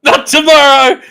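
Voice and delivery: loud, high defiant cry; rallying shout. Acted. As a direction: shout it like a rebel. Rally continues.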